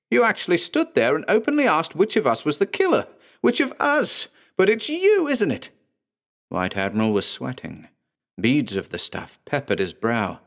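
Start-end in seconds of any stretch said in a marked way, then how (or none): 0.1-5.7 much higher, frenzied accusation; fever pitch. Acted. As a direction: ramp up to a near-shriek. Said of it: "rising to a fever pitch".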